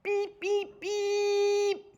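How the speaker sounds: high steady truck beeps; high beeping. A narrator's reading. The truck backs up to Stanza's place, high beeping. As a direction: beep like a backing truck, high and even. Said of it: "from the street came a high".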